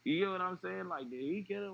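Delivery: fearing it was family; retold dread. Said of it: "it's like".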